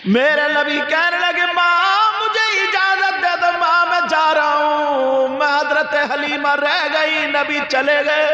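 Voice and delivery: high, loud wailing sermon voice with slow stretched lines; full-force preaching. Acted. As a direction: cry the story out to the crowd at full force. (ऊँची, ज़ोरदार तक़रीर की आवाज़)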